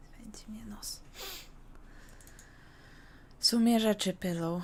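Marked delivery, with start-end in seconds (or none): 0.1-1.0 whispering. Real, not acted. Itchy nose aside.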